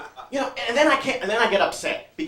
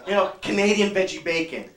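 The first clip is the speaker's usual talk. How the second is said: ordinary talk, nothing marked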